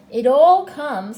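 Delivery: slow, very loud reproof; forceful, drawn-out scolding; stern. A narrator's reading. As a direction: lay down the law, stretching the words. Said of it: "said Rabbit sternly".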